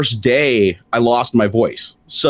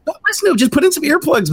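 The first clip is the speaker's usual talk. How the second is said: mocking advice.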